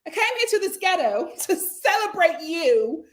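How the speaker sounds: high laughing mock voice; mock-sweet backhanded toast; mocking Jessel. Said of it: "You just like".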